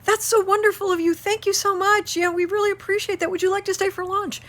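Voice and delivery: high, sugary thank-you voice; gushing neighbors. Retold or imitated. Pig-roast neighbors thanking dad for his concern, high gushing voice. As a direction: gush gratefully like overly polite Midwesterners. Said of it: "they were like".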